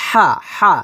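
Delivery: হা squeezed from the middle of the throat; thin, pressed ha. Shown as a demonstration. Teacher drilling the throat letter, হা with pressure. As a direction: press the h of হা finely. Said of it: "চিকুন করে চাপ দিয়ে".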